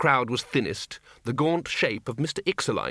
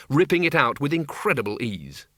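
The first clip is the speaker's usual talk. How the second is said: plain reading voice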